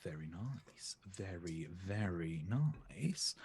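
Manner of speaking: soft, slow whisper. Real, not acted. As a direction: whisper it slowly, approvingly. Soft hushed approval.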